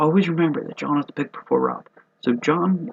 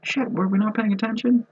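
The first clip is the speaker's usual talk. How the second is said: high, incredulous outburst at a steal; mock disbelief; 12 semitones higher than usual. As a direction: squeak out how did they miss this. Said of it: "where it's like".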